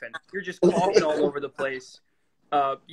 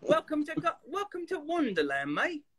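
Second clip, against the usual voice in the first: high, soft, hammed-up British lilt; bad British accent; 10 semitones higher, 6 dB quieter than usual. Actor recalling early attempt at the role, bad British accent. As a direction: overdo a phony British accent. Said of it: "had no idea and bad British accent".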